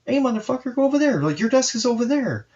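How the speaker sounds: high-pitched retold brush-off; mock tough guy. To the guy at his desk, a high mock shove-off. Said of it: "And I walk up and I'm like".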